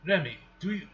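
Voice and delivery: put-on slipping into a Russian accent; accidental Russian accent. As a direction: read it with a stray Russian accent. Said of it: "doing a Russian accent".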